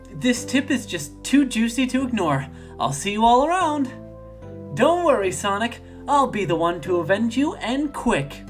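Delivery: put-on high, spirited girl's voice; eager birthday detective. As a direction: pitch it up, bubbly and determined. Voices Amy rushing off.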